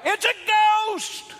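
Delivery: high-pitched mock scream of alarm; terrified cry. Preacher acting out frightened disciples in the boat, crying out. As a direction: cry it out high, as if it really happened. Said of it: "and they cried out".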